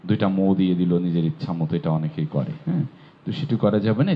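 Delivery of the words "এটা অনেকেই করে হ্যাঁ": normal talking voice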